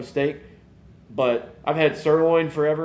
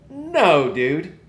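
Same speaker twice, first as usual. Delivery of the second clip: sudden high, loud "no" of fake outrage; mock outraged no; 4 semitones higher, 4 dB louder than usual. Dad to son rejecting McDonald's, mock outraged. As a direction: shoot down the kid's choice with a yelped no. Refusing son's McDonald's pick.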